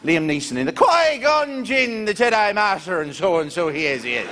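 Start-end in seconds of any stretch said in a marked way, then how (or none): none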